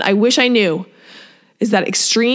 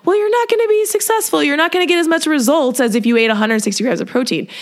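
much higher mocking voice of doubt; nagging inner voice; 5 semitones higher than usual. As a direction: play the doubting voice in your head. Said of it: "people's brains start screaming and being like".

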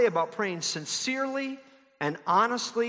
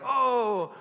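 lofty, hammed-up King James prayer voice; mock flowery old English prayer; 4 semitones higher than usual. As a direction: pray like a pompous Shakespearean orator. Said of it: "start addressing God like".

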